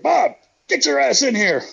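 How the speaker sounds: high, loud mock holler across the room; imitating Evil's holler. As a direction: call him in like the boss ordering a friend. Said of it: "Evil's like".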